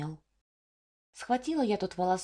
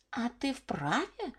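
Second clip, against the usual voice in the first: slow, soft, hissed jab; hissing; 3 semitones higher, 4 dB quieter, slower than usual. A narrator's reading. Old beetle hisses back at Varya, slow and soft. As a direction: hiss it out slowly at her. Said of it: "зашипела жужелица".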